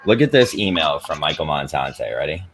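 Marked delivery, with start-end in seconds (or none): none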